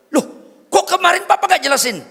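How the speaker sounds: high, loud reproachful wife; wife scolding her husband for yesterday; indignant wife. Retold or imitated. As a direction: demand it like a wronged wife. Wife's indignant question.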